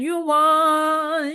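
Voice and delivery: high, bright-sounding mock reveal; sarcastic announcement. Vlogger mock-announces the winners, high and sarcastic.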